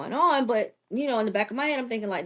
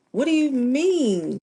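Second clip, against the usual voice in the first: louder, higher, drawn-out retold shock; shocked disbelief; 6 semitones higher, 5 dB louder, slower than usual. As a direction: relive the disbelief, stretching the words slowly. Retold shock at news of death.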